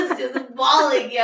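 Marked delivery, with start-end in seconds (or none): none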